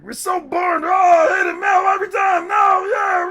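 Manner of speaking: high, strained mock-whiny shout; mocking the ranter. Streamer imitating the ranting guy, mock whiny shout. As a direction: parody an overdramatic streamer losing it. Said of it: "I was like".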